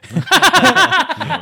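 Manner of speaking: high, loud laugh; burst of laughter. Real, not acted. Friend cracks up telling his noodle story, real high laughter.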